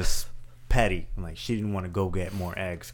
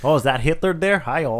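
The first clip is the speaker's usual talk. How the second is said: put-on loud, high Minnesotan accent; hammed-up Minnesota greeting; Minnesotan Nazi; 8 semitones higher, 7 dB louder than usual. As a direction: chipper mock-Minnesotan, pitched up. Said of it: "that Minnesotan Nazi".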